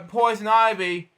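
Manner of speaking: ordinary delivery, nothing marked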